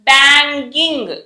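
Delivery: బ్యాంగింగ్ slow, "ng" held; NG sound. Shown as a demonstration. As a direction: stretch బ్యాంగింగ్ into bang-ging. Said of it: "జ్ఞా sound వస్తుంది చూసారా".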